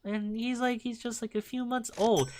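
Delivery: high, mock old lady gushing about her puppy. Imitating the old lady showing off her new puppy, high and doting. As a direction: coo it like a proud new pet owner. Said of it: "she was, like, saying".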